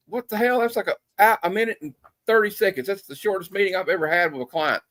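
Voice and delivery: retold high-pitched disbelief; incredulous agent. Agent incredulous after disastrous short meeting.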